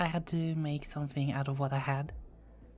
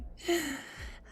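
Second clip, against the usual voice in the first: high playful giggle; light mischievous laugh; teasing giggle; 13 semitones higher than usual. Acted. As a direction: giggle at his blushing. Teasing the flustered listener.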